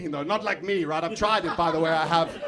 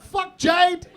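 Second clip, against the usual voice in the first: loud exasperated; 11 semitones higher, 7 dB louder than usual. Retold or imitated. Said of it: "I was like".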